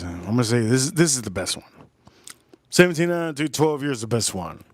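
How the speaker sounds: usual speaking voice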